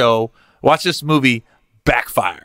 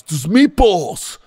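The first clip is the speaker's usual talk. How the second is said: out of breath mafia guy; 6 semitones higher than usual. Retold or imitated.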